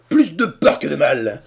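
loud, high, plus hit hard, falling; outraged shout. A narrator's reading. Cornelius jumps from bed and shouts back Harrison's words. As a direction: fling back plus de peur que de mal in outrage. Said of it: "s'écria-t-il".

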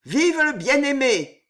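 high, loud ringing cheer; ringing shout. Acted. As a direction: cry it out over the crowd. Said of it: "cria ... la voix éclatante".

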